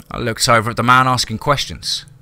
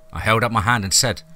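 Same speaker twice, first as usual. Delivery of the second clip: usual speaking voice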